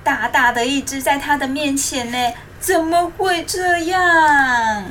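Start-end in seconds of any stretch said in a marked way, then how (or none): 2.6-4.8 slow, drawn-out dismayed question; surprised. Acted. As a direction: gasp it out in disbelief. Narrator voicing Xiaobai's shocked thought.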